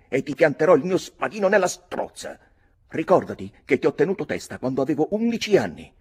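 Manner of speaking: put-on high, loud boyish fury; furious threat. Redmond threatening cousin Mick, high and furious. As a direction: threaten him with a sword, shaking with rage.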